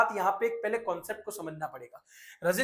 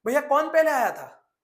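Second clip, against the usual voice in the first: loud, higher, coaxing question to a friend; 6 semitones higher, 6 dB louder than usual. Retold or imitated. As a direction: call out to your friend, higher and louder. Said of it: "अरे उसको समझाओ". (दोस्त को ऊँची आवाज़ में समझाते हुए)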